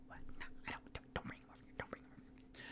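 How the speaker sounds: barely voiced, urgent hush; panicked whisper. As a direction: hiss it so he won't hear. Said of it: "Tipsy's like".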